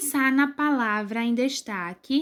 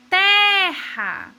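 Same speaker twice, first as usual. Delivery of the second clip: terra higher and louder, with a long strong te; stress on TE; 6 semitones higher, 5 dB louder than usual. Shown as a demonstration. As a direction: punch the first syllable of terra. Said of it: "qual é a sílaba que é falada com mais força".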